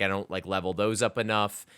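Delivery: usual speaking voice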